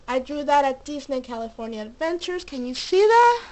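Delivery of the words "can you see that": high, loud eager question; excited. Real, not acted. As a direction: ask it eagerly, lifting up. Showing a drawing to camera.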